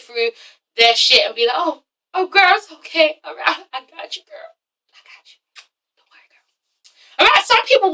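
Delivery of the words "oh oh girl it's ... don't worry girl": soft, high coo fading to a whisper; mock comforting. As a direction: soothe a friend, trailing into whispers. Voicing a friend comforting someone upset.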